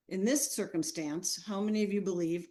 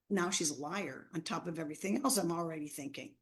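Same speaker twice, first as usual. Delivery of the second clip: ordinary talk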